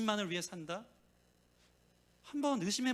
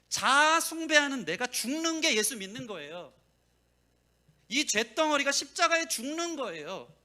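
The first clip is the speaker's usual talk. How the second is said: louder and higher, slowing each phrase; preaching at full force; 6 semitones higher, 7 dB louder than usual. Acted. Preacher crying out to the congregation, loud and rising. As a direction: build to a ringing climax.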